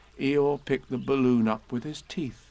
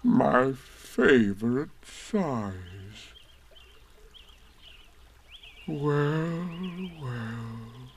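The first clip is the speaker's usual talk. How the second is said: put-on very slow, mournful old donkey; gloomy donkey; much slower than usual. A narrator's reading. Eeyore trailing off sadly to himself. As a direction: drag it out, resigned and gloomy.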